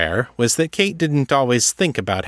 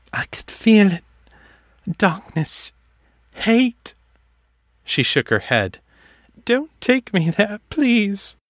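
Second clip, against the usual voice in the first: put-on much higher, trembling fearful girl; pleading in terror; frightened girl; 11 semitones higher than usual. A narrator's reading.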